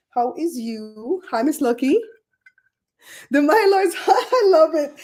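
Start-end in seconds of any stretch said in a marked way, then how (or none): 3.3-4.9 laughing through the words, raised and louder; laughing while reading. Real, not acted. Host laughing as she reads a viewer's comment.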